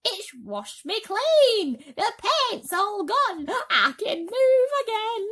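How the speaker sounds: very high, slow joyful shout; excited shout. Acted. Centipede freed of paint, overjoyed high shout. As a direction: shout it with glee.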